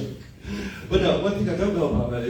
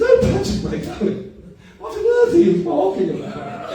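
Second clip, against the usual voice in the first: high, loud, slow mock-posh mother; posh mum impression; 8 dB louder than usual. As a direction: put on the airs of a posh mum. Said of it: "it's the way she talks".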